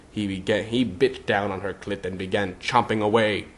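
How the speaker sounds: ordinary delivery, nothing marked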